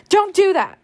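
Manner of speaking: high, loud angry shout. Acted. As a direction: snap it out loud at her aunt. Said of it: "shouted Scarlett".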